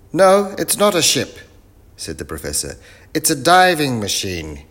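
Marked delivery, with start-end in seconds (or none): 0.1-1.4 loud, very high professor voice; muddled professor. Acted. Professor mishears the greeting, answers loud and high. 3.1-4.6 loud, high professor voice; eccentric professor. Acted. Professor sizes up the young crew, loud and high.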